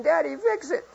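put-on high-pitched little child's plea; pleading toddler. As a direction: imitate a tearful toddler asking Daddy for help. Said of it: "his little two-year-old face".

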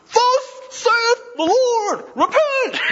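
high, slow, screamed preacher's call; mock street preacher scream. Retelling the campus preacher yelling at students, screaming. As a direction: bellow it like a fire-and-brimstone preacher. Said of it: "He's just screaming at all the students".

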